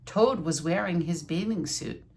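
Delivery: ordinary delivery, nothing marked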